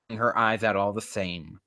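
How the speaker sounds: normal talking voice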